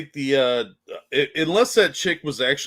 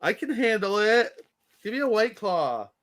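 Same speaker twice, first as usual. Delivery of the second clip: put-on whiny drunk girl; 9 semitones higher than usual. Playing the drunk woman.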